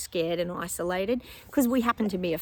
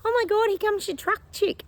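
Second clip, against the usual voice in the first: much higher, a thrilled husband spotting the truck; excited husband; 14 semitones higher, 3 dB louder than usual. Retold or imitated. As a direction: squeal it out like he can't believe it. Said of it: "And Tim goes".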